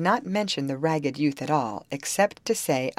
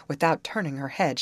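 ordinary delivery

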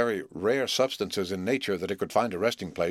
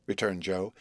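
ordinary delivery

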